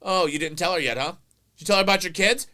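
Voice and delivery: loud, high teasing taunt. Retold or imitated. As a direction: needle him loudly. Brother teasing.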